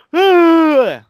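big, high-pitched, falling sigh; exasperated loud sigh; loud groaning sigh. Real, not acted. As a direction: let out a loud, high exhale that drops off. Exasperated noise, then says sorry.

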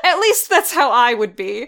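usual speaking voice

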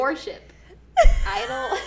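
high squeaky laugh-talk; giggling through the words. Real, not acted. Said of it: "I'm, like, wheezing".

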